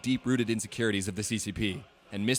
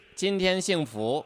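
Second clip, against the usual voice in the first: higher-pitched southern Chinese accent; southern-accented Mandarin, pitched up; 6 semitones higher, 4 dB louder than usual. Shown as a demonstration. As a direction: imitate a southern Chinese speaker. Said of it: "sounds like a Chinese person".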